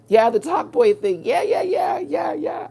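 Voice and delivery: high, loud excited yeahs. Real, not acted. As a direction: burst out in eager recognition. Excited recognition of the Talk Boy.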